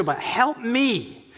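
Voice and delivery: each word slow and deliberate; the woman insisting to Jesus, slowly; slow insistent plea. Retold or imitated.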